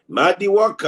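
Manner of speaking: loud, high-pitched name call. Real, not acted. Calls out a viewer's name.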